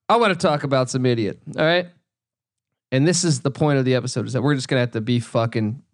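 ordinary delivery

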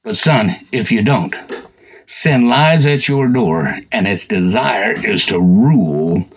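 low, slow, grave fatherly voice; God speaking to Cain. Retold or imitated. God warning Cain about sin, low slow counsel. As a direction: counsel a sulking son, slow and deep. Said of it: "God comes out and says".

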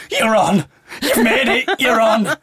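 guard waving her onto the train, excited. Retold or imitated. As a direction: announce the win with a grin.

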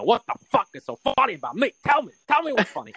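high, rising hammed-up rant; mock freaking out. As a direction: play the touchy mobster losing it. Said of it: "just freaking out".

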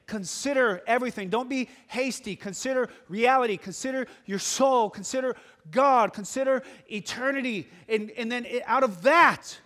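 fervent pulpit exhortation; full-force preaching. Acted. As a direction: preach it hard to the whole room.

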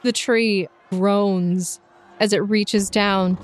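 ordinary talk

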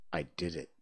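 low, soft, reflective admission; subdued musing; reflecting. A narrator's reading. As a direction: say it quietly, as if thinking back. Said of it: "he said reflectingly".

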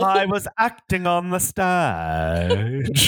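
slow, high, hammy stage actor; theatrical thespian. Acted. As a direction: declaim it grandly, drawing out each word.

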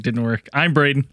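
usual speaking voice

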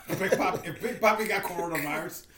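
laughing while talking. Real, not acted. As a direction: laugh through the words. Laughing through a joke.

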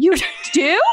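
high-pitched, disbelieving question; acting out being caught off guard; bumbling surprise. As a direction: squeak it out, amazed anyone said yes. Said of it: "like, the most bumbling, awful".

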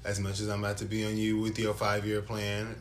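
normal talking voice

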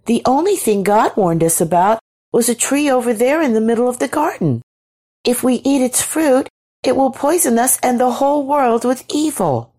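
high-pitched innocent woman's voice; light, high character voice; cheerful Eve. A narrator's reading. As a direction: explain cheerfully, pitched well up. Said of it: "said Eve cheerfully".

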